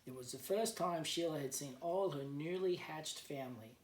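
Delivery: ordinary delivery, nothing marked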